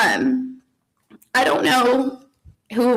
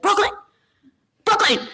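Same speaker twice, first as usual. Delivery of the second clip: mock startled yell, higher; a husband poked while working on the car, mock yelling her name; imitating dad yelling; 12 semitones higher than usual. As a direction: playfully voice the dad calling out. Husband's poked reaction, retold.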